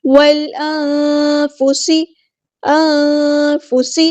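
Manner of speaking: انفس with the noon hidden and nasal; انفس, noon sound hidden through the nose; ikhfa on noon. Shown as a demonstration. Teacher models ikhfa for students, hidden nasal noon. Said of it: "نون ساکن کی آواز کو یہاں پہ چھپانا ہے".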